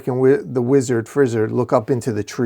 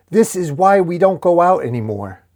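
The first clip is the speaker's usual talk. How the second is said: raised, fed-up wail; exasperated complaint; 6 semitones higher, 4 dB louder than usual. A narrator's reading. Dork scolding the violent shield, exasperated. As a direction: complain loudly at an embarrassing companion.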